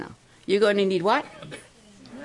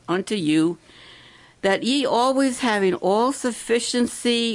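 slow, measured verse reading, a bit louder; preacher reading a Bible verse to the campers; scripture reading; 5 dB louder, slower than usual. Acted. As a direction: read the verse out solemnly, word by word.

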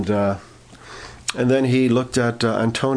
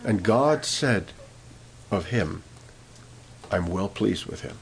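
lower, softer and slow, reverent hush; softly awed preacher's hush; hushed tender; 4 semitones lower, 6 dB quieter, slower than usual. Real, not acted. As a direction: draw them in close with a quiet blessing. Quiet climax of sermon point.

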